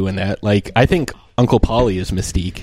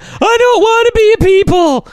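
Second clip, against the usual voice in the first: very high, loud mock wail; hammed-up squealing tantrum; imitated whining partner; 5 dB louder than usual. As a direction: screech it like a sulky kid. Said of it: "She's like".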